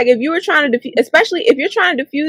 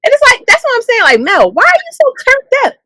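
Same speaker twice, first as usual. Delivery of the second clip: incredulous; 11 semitones higher than usual. Real, not acted. Baffled at Mel's aggression.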